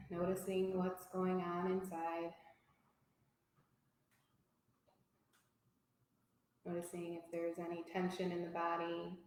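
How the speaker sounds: soft, unhurried yoga teacher voice with long pauses; guided relaxation. Real, not acted. Teacher guiding a yoga class to notice body and breath, calm guided relaxation. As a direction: settle them gently into stillness.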